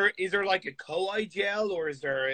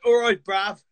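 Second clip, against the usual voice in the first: imitated British street slang, higher and louder; UK slang accent; 6 semitones higher, 8 dB louder than usual. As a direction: put on a loud, slangy UK accent. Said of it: "very slang U K accent".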